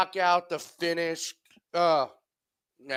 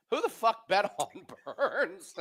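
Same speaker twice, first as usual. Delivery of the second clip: laughing; 5 semitones higher, 4 dB quieter than usual. Real, not acted. Laughs mid line.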